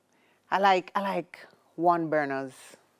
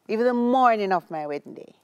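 usual speaking voice

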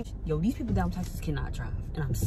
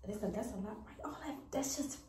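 whispering on the phone; 14 dB quieter than usual. Real, not acted. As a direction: keep it hushed, someone's asleep. Talking quietly in bed at home.

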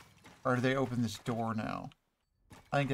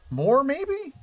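hopeful high lilt; 12 semitones higher, 6 dB louder than usual. Real, not acted. Hoping to have more eggs.

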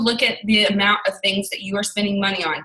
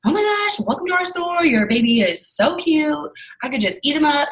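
much higher, gushing store welcome; excited shop girl; 7 semitones higher than usual. Retold or imitated. Shop assistant greeting a customer and baby, gushing and much higher. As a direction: squeal a bubbly welcome to the customers. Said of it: "she was just so excited and personable".

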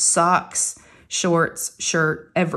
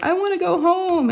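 put-on high whiny kid voice; mock child whine; upset child; 11 semitones higher than usual. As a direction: imitate her upset whine. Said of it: "she's like".

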